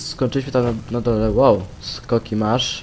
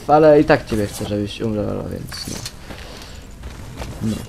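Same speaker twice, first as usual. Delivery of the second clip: ordinary talk, nothing marked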